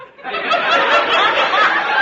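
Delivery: chuckle. Real, not acted. Principal laughs at own quip.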